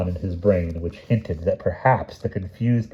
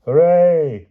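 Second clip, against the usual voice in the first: loud, higher forced cheer; obedient cheer; 9 semitones higher, 7 dB louder than usual. Acted. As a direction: cheer because you're told to. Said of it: "cried the terrified serfs obediently".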